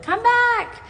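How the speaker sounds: high, pleading mock call; calling after words. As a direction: squeak out a comic plea to fleeing words.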